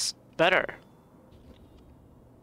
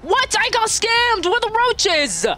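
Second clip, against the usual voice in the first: high, indignant outcry; outraged disbelief; 10 semitones higher than usual. Real, not acted. Player cheated out of roaches, indignant outcry. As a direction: wail it in high protest.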